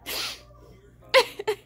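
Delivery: high, squeaky tearful laughter; words squeezed out through helpless laughing; laughing till crying. Real, not acted. Cosplayer cracking up at friend's tour, real laughing till crying. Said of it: "I'm literally fucking crying".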